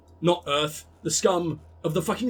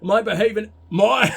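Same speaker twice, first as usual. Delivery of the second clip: American attempt slipping loudly into Cockney; Cockney slip; 3 semitones higher, 6 dB louder than usual. Real, not acted. Coach voice lecturing the team, accidental Cockney slip. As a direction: let Cockney vowels creep into "my". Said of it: "I can't keep the Cockney out of my voice".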